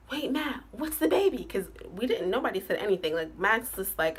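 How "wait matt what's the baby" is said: higher, urgent retold ask; asking the sex, pitch climbing, louder at the end; eager question.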